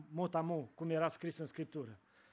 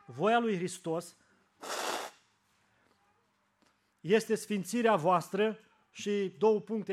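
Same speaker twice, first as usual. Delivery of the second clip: slow, louder solemn verse reading; scripture reading; 3 semitones higher, 6 dB louder, slower than usual. Acted. Preacher proclaims the verse to his flock, slow and loud.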